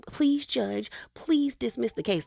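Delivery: a lightly mocking high plea to a judge; corny courtroom plea. Mocking Bronwyn's corny line, a hammed-up plea. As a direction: play up the cheesy courtroom line. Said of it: "That was so corny".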